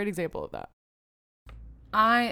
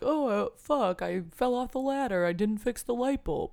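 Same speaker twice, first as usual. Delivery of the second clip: mock hapless sitcom dad, pitched up; dumb sitcom dad; 5 semitones higher than usual. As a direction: play the clueless nineties TV dad, dragging it out. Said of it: "All the guys were like".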